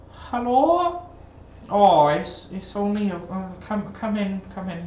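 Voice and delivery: put-on high-pitched old lady welcome; high, halting little old woman voice. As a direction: greet visitors as a doddery old lady. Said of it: "There's a little old woman".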